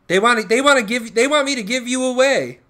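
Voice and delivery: high, loud pet-talk voice; loud high cooing indignation. Real, not acted. Talking to his cat.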